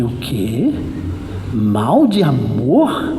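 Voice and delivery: low, drawn-out question swooping up at the end; incredulous echo. Retold or imitated. Preacher reacts to talk of lovesickness, incredulous. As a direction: echo it slowly in mock disbelief. Echoes the diagnosis in disbelief.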